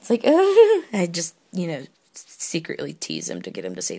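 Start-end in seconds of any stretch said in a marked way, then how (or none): 0.3-1.0 high, loud giggly squeal; swooning giggle. Retold or imitated. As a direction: squeal as if melting at the sound. Acted reaction to his words.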